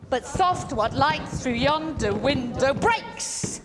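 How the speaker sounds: stomp out each strong beat; heavy da-DUM pulse up to a big "breaks"; galloped iambic rhythm. Shown as a demonstration. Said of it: "with that rhythm really strongly".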